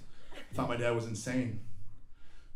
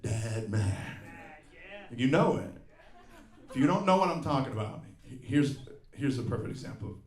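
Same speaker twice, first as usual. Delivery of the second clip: ordinary talk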